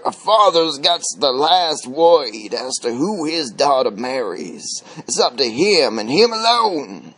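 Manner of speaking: plain reading voice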